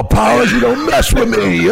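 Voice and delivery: high, loud, slow mock threat; hammed-up wrestler threat; threatening growl. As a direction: pin him to the wall with it. Grabs Powers against wall.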